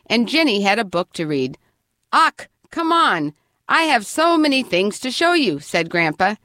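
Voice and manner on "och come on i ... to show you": put-on Scottish accent. A narrator's reading. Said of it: "He spoke using Scottish words".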